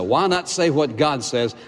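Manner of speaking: usual speaking voice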